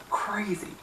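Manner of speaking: ordinary talk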